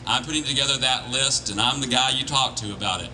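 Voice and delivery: ordinary talk, nothing marked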